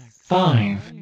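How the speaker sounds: normal talking voice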